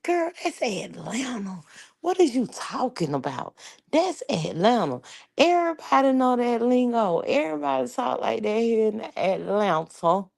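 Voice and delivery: high, insistent defence of Atlanta; animated retort. Retold or imitated. On the phone defending the Atlanta lingo, high and animated. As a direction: set the friend straight, high and emphatic. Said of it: "And I'm like".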